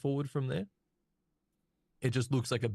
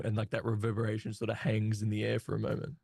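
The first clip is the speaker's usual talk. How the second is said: usual speaking voice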